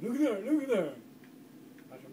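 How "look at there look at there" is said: high, loud urgent pointing; excited call. Retold or imitated. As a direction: call it out like you just spotted something. Uncle spotting animal, excited call.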